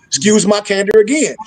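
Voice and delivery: ordinary talk, nothing marked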